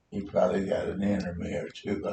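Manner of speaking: normal talking voice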